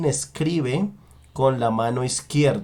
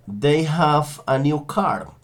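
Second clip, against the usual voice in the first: slow dictation; slower than usual. Shown as a demonstration.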